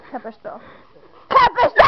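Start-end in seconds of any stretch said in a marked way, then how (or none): 1.3-1.8 very high, loud shriek of disbelief; real distraught shriek; hysterical scream. Real, not acted. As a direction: scream the question in a breakdown. Same question escalates into scream.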